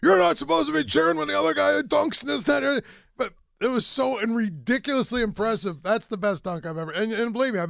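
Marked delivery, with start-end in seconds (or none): 0.0-2.8 high, loud scolding; yelling coach. Retold or imitated. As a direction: bark at the bench. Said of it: "starts screaming at us".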